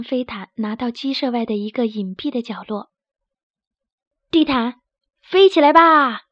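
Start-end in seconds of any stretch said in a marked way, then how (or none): 4.3-6.2 slow, loud drawn-out command. Acted. Loudmouth standing on the carpet, commanding it to fly, slow loud order. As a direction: order it into the air, stretched out. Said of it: "大嗓门儿站在飞毯上命令道".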